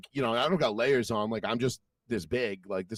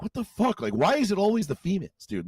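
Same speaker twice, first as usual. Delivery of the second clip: incredulous; 7 semitones higher than usual. Retold or imitated. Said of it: "we're like".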